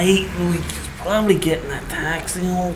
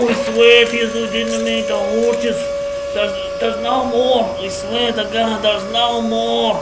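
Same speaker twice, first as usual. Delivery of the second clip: panicked begging; 3 semitones higher than usual. Acted. Man dangled in the air begs to be put down, panicked. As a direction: plead frantically for release.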